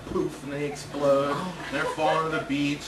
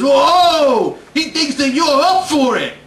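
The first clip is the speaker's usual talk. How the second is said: much louder, higher warning cry; alarmed shout; 8 semitones higher, 11 dB louder, slower than usual. Retold or imitated. Old guy shouting warning.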